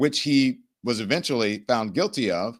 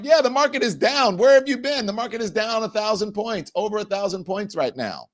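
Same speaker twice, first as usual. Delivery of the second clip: high-pitched, incredulous insistence; exasperated; 9 semitones higher than usual. Real, not acted. Host answering a viewer who missed the crash, exasperated. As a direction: fire it back as if they should know already.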